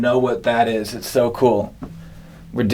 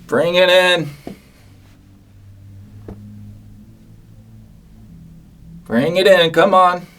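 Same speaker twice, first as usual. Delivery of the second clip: calling out; 4 semitones higher than usual. Real, not acted. Calling for the surprise.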